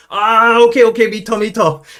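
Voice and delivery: high, loud taxi driver relenting; mock exasperated, high-pitched agreement; driver giving in. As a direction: call them back with a loud okay. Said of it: "they'd be like".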